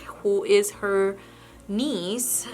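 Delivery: ordinary talk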